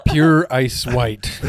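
slow deadpan. Acted. Joking about own background.

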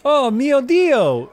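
high, slow shocked Italian chef; Italian accent. Acted. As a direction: gasp it out slowly Italian. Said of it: "both boys speak in thick Italian accents".

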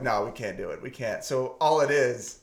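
ordinary delivery

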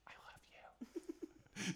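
soft, unvoiced mouthing; mouthed whisper. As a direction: barely breathe the words, unsettling. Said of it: "mouths in the most unsettling way ever".

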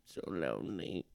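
soft, eerie closing words; spooky hush. Acted. Spooky story ending.

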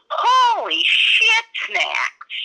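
high, drawn-out catchphrase yell; Pam Poovey. Shown as a demonstration. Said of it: "her line that everybody kinda knows".

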